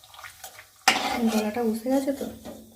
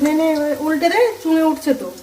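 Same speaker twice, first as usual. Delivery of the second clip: raised, hurried urging to flip it; urgent instruction; 4 semitones higher, 6 dB louder than usual. Real, not acted. Mother urging daughter to flip the papad, raised voice. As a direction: call over to her to turn it now.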